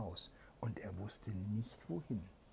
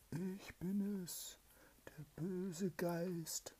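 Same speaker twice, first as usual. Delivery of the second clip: high, very slow ghostly whisper; spooky spirit whisper; 10 semitones higher, much slower than usual. Acted. Spirit reveals itself to the soldier, slow high ghostly whisper. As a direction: breathe it out slow and eerie like a ghost. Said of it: "antwortete eine Stimme".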